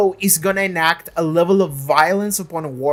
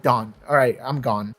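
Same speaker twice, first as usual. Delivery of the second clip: low, flat and offhand, a lightly voiced Willow; mock-casual low mutter of a killer walking away; deadpan dismissal; 6 semitones lower, 6 dB quieter than usual. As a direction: shrug it off like the job is finished. Willow finishing the killing, voiced.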